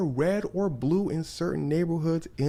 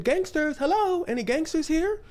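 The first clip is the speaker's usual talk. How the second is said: high-pitched searching call; 13 semitones higher than usual. Retold or imitated. Storyteller as the star's staff hunting for recruits, high mock calling. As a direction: call into the street for anyone around, comically high.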